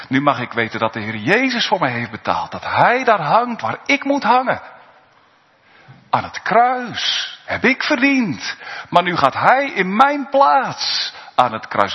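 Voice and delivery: normal talking voice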